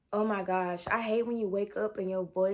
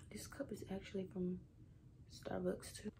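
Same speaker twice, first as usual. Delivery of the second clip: whisper; 13 dB quieter than usual. Real, not acted. Continues quiet aside.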